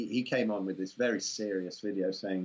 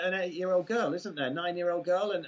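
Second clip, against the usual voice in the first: ordinary delivery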